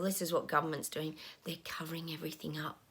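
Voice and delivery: hushed confiding whisper. Real, not acted. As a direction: lean in and whisper the secret. Confides government cover-up in hushed tone.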